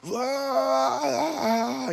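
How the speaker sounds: long high-pitched "aah"; imitated wail, held high; mock freak-out wail. Prue freaks out during the rite, mock high wail. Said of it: "Prue starts freaking out".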